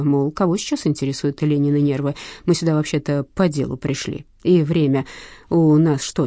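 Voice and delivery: plain reading voice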